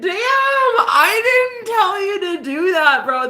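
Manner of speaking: high, drawn-out exasperation; retold protest. Girlfriend retelling her answer to boyfriend, high exasperated protest. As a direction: stretch it out in a high-pitched protest. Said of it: "I was like".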